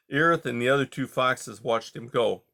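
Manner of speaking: plain reading voice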